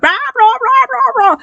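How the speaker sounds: high-pitched mock screaming noise; mimicked screaming. Parent screaming at the kids, mimicked as high wordless yelling. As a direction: imitate being screamed at, far above normal pitch. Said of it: "just got screamed at".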